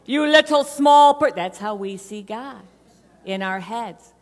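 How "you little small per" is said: booming Oz-like God. Retold or imitated. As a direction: thunder down grandly at a tiny supplicant. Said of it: "he's going".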